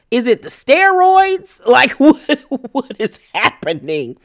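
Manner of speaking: high incredulous question breaking into a laugh; laughing disbelief. Acted. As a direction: ask it baffled, pitch leaping up. Mock disbelief at Brandy's behaviour.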